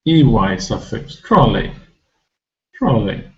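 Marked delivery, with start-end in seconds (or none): 1.2-1.7 trolley with a short unstressed ending; short final vowel. Shown as a demonstration. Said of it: "we don't pronounce E Y as a long". 2.7-3.2 trolley with a short unstressed ending; short final vowel. Shown as a demonstration. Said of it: "we don't pronounce E Y as a long".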